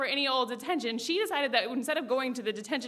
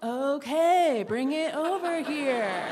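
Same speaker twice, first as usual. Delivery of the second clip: slower, louder, spelled-out okay; patient insistence; 3 semitones higher, 3 dB louder, much slower than usual. Retold or imitated. Girl repeating her offer, slow and emphatic. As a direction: say it again, more deliberately this time.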